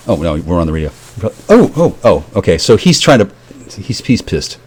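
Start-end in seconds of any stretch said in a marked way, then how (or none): none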